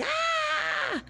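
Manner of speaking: high sudden scream of fright, imitating mom; imitating mom's frightened scream. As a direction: shriek like a startled mother who just saw a cat. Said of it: "And she's like".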